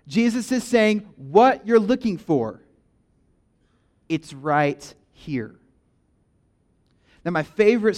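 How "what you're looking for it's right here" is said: "What you're looking for" dragged out slow and louder, then a long pause; slow emphasis. Acted. Pastor voicing Jesus to the crowd, slow and emphatic. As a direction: land each word slowly, with weight.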